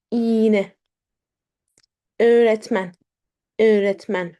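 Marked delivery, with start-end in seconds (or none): none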